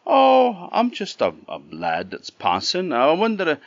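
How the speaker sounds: put-on high, eager boyish reply; Jack's voice. A narrator's reading. Jack explains himself to the giant's wife, high and eager. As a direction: pipe up like a hopeful lad. Jack answers the tall woman.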